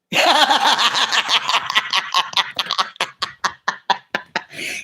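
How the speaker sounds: high bubbly giggle; high giggle before greeting; high laugh. Real, not acted. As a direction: giggle with delight.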